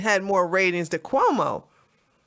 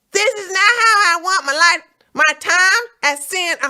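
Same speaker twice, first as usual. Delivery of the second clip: higher, louder whiny Fredo voice; 8 semitones higher, 6 dB louder than usual. Retold or imitated. Streamer reading Cuomo's tweet as Fredo, whiny and loud. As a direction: whine it like Fredo from The Godfather. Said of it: "so I can get that Fredo voice".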